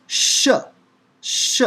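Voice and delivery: SH as an unvoiced hush; shushing sound. Shown as a demonstration. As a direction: shush like a librarian. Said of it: "like the librarian shushing you".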